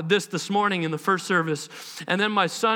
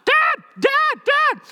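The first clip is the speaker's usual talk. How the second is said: put-on child calling; 7 dB louder, much slower than usual. Son interrupting, calling dad.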